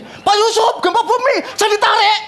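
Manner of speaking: high, laughing mock panic. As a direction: play a frightened woman in a quake. Woman panics during earthquake. (panik yang ditirukan, nada tinggi)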